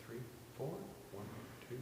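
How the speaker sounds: quiet, small counting for a soft beat. Shown as a demonstration. Conductor demonstrating a soft beat, counting quietly. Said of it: "That's soft".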